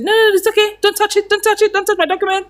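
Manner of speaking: high-pitched, upset protest; upset boss. Retold or imitated. CFO snatching scattered papers back from the receptionist, high upset protest. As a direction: squeal it like a flustered boss guarding his papers. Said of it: "he was so upset".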